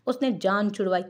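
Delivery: ordinary delivery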